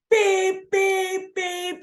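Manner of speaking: high squeaky beep beep beep; imitated car horn. Honking at a slow driver.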